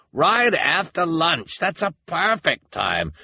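high, drawn-out townsperson's call; eager agreement. A narrator's reading. Townsperson approves the time for the burning, eager call. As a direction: welcome the plan heartily. Someone calls from crowd.

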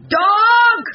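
loud, high farmer's roar; furious drawn-out yell. A narrator's reading. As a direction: bellow for the dog. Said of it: "roared a man's voice".

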